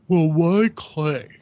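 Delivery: mock academic questioning. Mimics academic questioners at artist talks.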